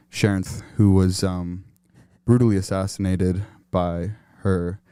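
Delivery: low, halting, deadpan solemn delivery; mock funeral officiant. Officiant introducing a eulogy to mourners, mock solemn. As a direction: pretend to introduce a eulogy with grave pauses.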